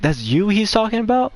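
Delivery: retold surprise. Sister's retold astonished question.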